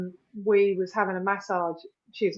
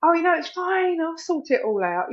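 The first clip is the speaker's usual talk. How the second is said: higher, louder cheery dismissal; airy "it's fine" to wave off worry; breezy reassurance; 9 semitones higher, 4 dB louder than usual. Retold or imitated. People pleaser offering to help.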